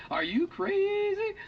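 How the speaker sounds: very high, drawn-out squeal; mock disbelief. Auctioneer teases crowd ignoring thousand-dollar price, mock disbelief. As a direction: stretch it out far up high, in mock outrage.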